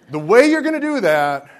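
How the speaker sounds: high, loud, emphatic apostle's command; Paul exhorting loudly. Pretend. Preacher paraphrasing Paul to the Philippians, loud exhortation. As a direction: call it out like Paul scolding his church. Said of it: "this is my paraphrase".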